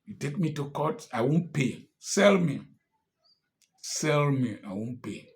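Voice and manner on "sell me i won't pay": ordinary talk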